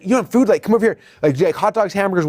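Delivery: high, eager mock surprise; surprised salesperson. Salesperson surprised a customer has no food, high and eager. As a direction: act shocked he has no food.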